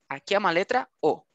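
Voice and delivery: ordinary delivery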